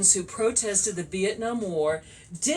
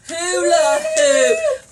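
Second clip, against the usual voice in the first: loud, drawn-out celebratory call; excited announcement; 9 dB louder, much slower than usual. Real, not acted. Host reveals the fad to the quiz winner, excited drawn-out shout. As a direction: belt out the answer, stretching the last word.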